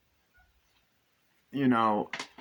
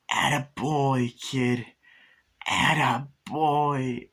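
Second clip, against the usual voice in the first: slow, proud growl of a killer doll; imitating Chucky; 3 semitones higher, 3 dB louder, much slower than usual. Chucky praising Glenn, slow and proud. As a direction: drag it out, gloating. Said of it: "Chucky goes".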